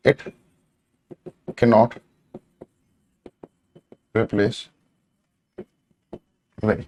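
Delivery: one word at a time, long pauses between; soft, slow, word-by-word writing pace; slow dictation. Real, not acted. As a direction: spell out the sentence slowly as if writing it. Dictating while writing on board.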